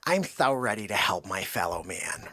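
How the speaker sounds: low, hushed put-on voice; mock-earnest imitation. Coworker volunteers at the clinic, mock-earnest hushed voice. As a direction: say it softly and low, mock noble.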